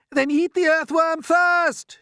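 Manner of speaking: high, loud, drawn-out shout; frantic shout. A narrator's reading. Centipede offering up the earthworm to the attacker, frantic shout. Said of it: "shouted the centipede".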